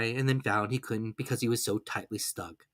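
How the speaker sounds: plain reading voice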